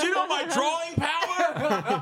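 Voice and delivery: high, slow, laughing brag; boastful reality star. Acted. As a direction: crow it like a smug reality star.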